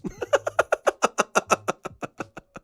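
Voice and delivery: long breathy run of laughter; helpless, mostly voiceless laughter; laughing. Real, not acted. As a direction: wheeze out a falling laugh. Laughs after reciting reactions.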